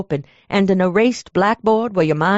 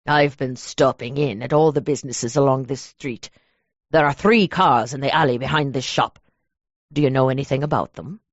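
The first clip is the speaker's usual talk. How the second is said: a lower, measured man's voice; lowered male voice; 6 semitones lower than usual. Acted. Derek introducing himself to the clerk, low and measured. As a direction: play the cold, polite stranger, low.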